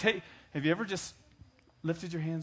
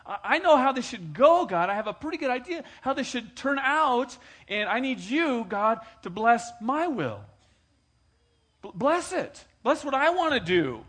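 high loud demanding prayer; preacher mocking selfish prayers, high and loud; 10 semitones higher, 10 dB louder than usual. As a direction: mimic a pushy believer telling God. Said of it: "we're saying".

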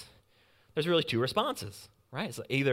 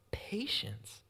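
whispered word; 5 semitones higher, 7 dB quieter than usual. Real, not acted. Preacher hushing the congregation on "patience", whispered.

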